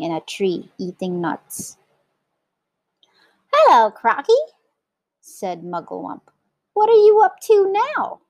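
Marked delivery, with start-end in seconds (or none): 3.5-4.4 high, loud monkey voice; chirpy monkey. A narrator's reading. Monkey in tree greeting crocodile, chirpy. 6.7-8.2 very high, loud monkey squeal; chirpy monkey. A narrator's reading. Monkey asking crocodile his business, high and chirpy.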